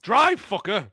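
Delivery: road-rage shout. Retold or imitated. Happy driver who still has road rage, mock road-rage shout. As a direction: bark it at a slow driver.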